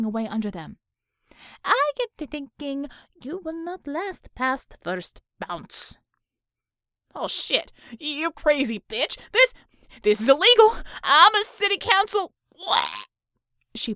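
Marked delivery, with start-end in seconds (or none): 1.6-6.0 Russian-sounding interrogator toying with a captive; tough interrogator, taunting. Acted. Nat threatens at the door. 7.1-12.3 high, loud, frightened captive; panicking man crying out; panicked protest. Acted. Captive dangled from speeding van.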